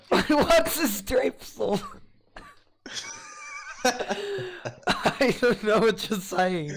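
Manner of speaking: slow, high, laughing words. Real, not acted. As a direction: giggle through the question. Laughing at friend's word.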